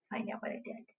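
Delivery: soft conspiratorial whisper; hushed suspense; secret whisper. Acted. Teacher builds suspense for children.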